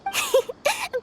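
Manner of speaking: high, knowing laugh; amused high laugh. Acted. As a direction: laugh at the naive question. Merchant amused by naive question.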